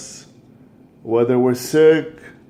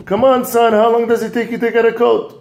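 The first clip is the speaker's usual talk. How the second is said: higher, louder, hurried nagging; impatient father; 7 semitones higher, 3 dB louder, faster than usual. Acted. Busy father calling his son in the attic, impatient. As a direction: rush him, you're out of patience.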